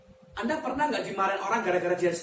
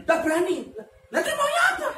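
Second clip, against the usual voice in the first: mock exasperated exchange; 9 semitones higher, 3 dB louder than usual. Quarrel between wife and pastor.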